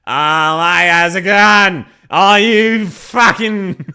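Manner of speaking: loud slurred drunk Australian impression; drunk Aussie. Streamer showing viewers what drunk Aussie is like, mock drunken bellow. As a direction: holler it like a plastered Aussie, dragging the words out. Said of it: "No, drunk Aussie is like".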